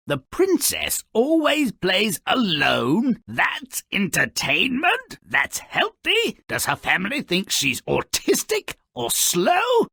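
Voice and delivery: can't believe what you're reading; incredulous questions. Real, not acted. Narrator scoffing at the princess who always plays alone, incredulous.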